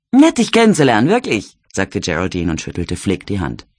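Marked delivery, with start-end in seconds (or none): 0.1-1.6 higher, brassy Cockney lady trying to sound proper; a barmaid putting on airs, pitched up; Jelly's genteel Cockney. A narrator's reading. Jelly teases Percy about visiting the slums, higher would-be posh Cockney. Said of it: "Sie klang wie eine Cockney".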